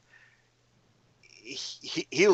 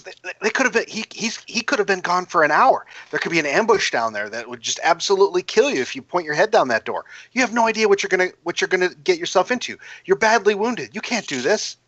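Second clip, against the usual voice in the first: higher, fussing voice over his wounds; worried scolding; 6 semitones higher, faster than usual. A narrator's reading. Cordelia frets at the wounded player, higher and fussing. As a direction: fret over him like a worried friend.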